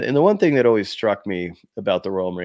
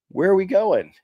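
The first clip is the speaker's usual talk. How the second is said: pitched way up, caught off guard; startled question; 10 semitones higher, 4 dB louder than usual. Retold or imitated. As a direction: ask it like you've just been woken with bad news. Confused reply to the commando.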